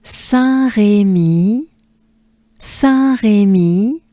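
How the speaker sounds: slow "Saint-Rémy" in French; French Saint-Rémy with throaty R and held ending; French name demo. Shown as a demonstration. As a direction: stretch Saint-Rémy for learners. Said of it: "Take a good breath with me".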